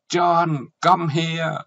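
high, loud call from the bathroom; calling out shrieked. Acted. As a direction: yell for John, high-pitched and drawn out. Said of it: "Tiếng con Susan tréo lên". (tiếng gọi ré lên, cao và to)

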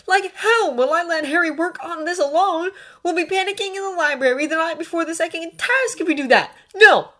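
high, loud, heated objection; indignant refusal. A narrator's reading. Draco refusing to leave Harry alone, indignant. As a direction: argue hotly, ending on a loud refusal.

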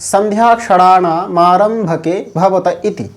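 reading the Sanskrit quote at half speed; slow sutra recitation. Shown as a demonstration. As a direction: chant the rule slowly, every syllable spaced. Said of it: "প্রমাণ হচ্ছে".

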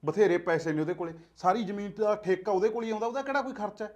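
hushed quarrel. Acted. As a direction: keep the fight down so the child sleeps. ਆ ਕੁੜੀ ਸੁੱਤੀ ਪਈ ਆ.

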